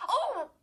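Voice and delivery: high, loud winded groan; girl groaning after a punch to the stomach; pained groan. A narrator's reading. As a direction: groan as the fist hits your stomach. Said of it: "Chris groaned".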